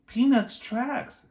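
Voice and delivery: put-on high, slow girl's voice; Annie's voice. A narrator's reading. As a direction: voice a worried little girl, up high. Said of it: "said Annie".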